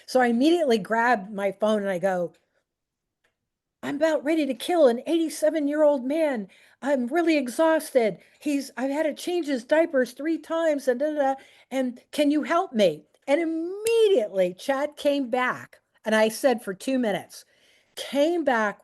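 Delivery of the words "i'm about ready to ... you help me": high, fast exhausted plea. Retold or imitated. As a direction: rush it out, pitched up high. Dictating plea to chat.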